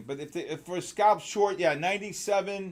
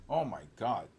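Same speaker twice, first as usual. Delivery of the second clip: ordinary talk, nothing marked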